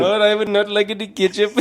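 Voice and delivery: high mock Indian accent, near laughter. Turning down ketchup at the drive-thru, mock Indian accent. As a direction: politely refuse in a put-on Indian accent. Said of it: "pass with that accent".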